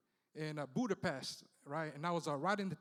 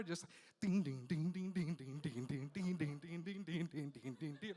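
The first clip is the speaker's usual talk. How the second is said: mock beeping; 3 semitones lower, 6 dB quieter than usual. Imitating nurses taking blood pressure.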